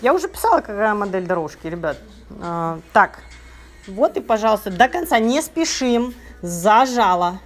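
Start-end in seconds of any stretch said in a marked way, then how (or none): none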